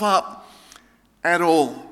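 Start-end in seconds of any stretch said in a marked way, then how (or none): none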